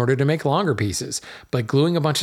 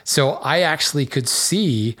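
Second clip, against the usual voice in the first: ordinary talk, nothing marked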